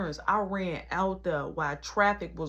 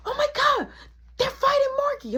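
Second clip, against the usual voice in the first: high shouted alarm, louder; retold frantic yell; 6 dB louder than usual. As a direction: yell it across the street in a panic. Caleb yelling across to them.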